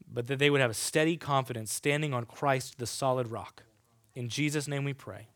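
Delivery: soft, earnest closing prayer. Real, not acted. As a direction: pray quietly and reverently. Let's pray.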